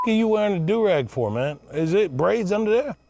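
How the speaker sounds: ordinary delivery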